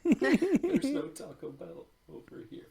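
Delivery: high and loud, slowed; a dramatic slow exclamation; excited. Real, not acted. Mock outrage at Taco Bell.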